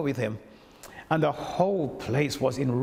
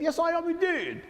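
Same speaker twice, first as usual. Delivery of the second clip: posh reply. Retold or imitated. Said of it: "He said".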